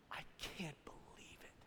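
hushed gossip's whisper; mock-shocked, voiceless and very soft; scandalized whisper. Acted gossip spreading scandal.

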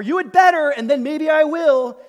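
loud, high, bossy warning; hammed-up demanding ultimatum; mock threat.

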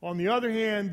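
ordinary talk

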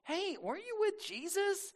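high, soft schoolgirl voice, put on; middle school girl. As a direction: pipe up as a young girl asking. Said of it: "middle school girl walks up".

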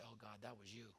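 soft, hushed thanks to God; hushed thanksgiving, almost to yourself; quiet grateful prayer. Shown as a demonstration. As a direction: murmur it like a private prayer. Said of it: "and you say".

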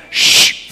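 a whispered shh; shushing. Retold or imitated. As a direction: hush him like a stern angel. Angel silencing Zechariah.

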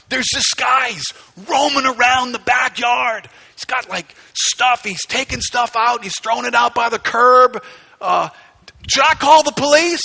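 loud, high, panicked report to a friend; acted alarm. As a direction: play a frantic caller reporting an intruder. Alarmed phone call about intruder.

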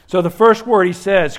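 loud, high, impassioned preaching; preaching at full force. Acted. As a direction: proclaim it to the whole congregation.

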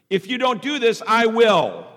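loud, high, forceful threat. Acted. Someone manipulating others through fear, threatening. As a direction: issue an ultimatum to scare them. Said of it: "Some of us do it in fear".

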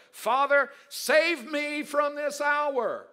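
cried out high, loud and slow; anguished plea. Acted. Jesus begging the Father in anguish, high loud plea. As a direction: plead with the Father, voice straining upward. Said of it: "What shall I pray to my Father".

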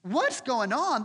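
a sharp high what, then slowed; puzzled incredulity; preacher stunned by the story; mock disbelief. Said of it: "I read this and I'm like".

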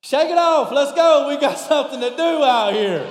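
loud, high hurry-up; impatient calling out. Acted. Pastor plays someone hurrying a god out of the bathroom, loud and impatient. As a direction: holler them into action.

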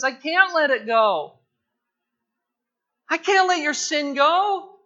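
firm refusal. Acted. Preacher voicing God refusing to overlook sin, loud and firm. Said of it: "God says".

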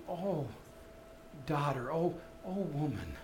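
soft, low, tender "oh, woman"; gentle tender Jesus. Retold or imitated. Preacher voices Jesus tenderly calling the woman, soft. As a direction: say "woman." softly as to a loved daughter. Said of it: "he was just going".

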